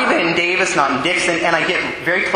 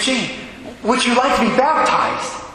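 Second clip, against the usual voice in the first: excited; 7 semitones higher than usual. Retold or imitated. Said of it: "I was very excited".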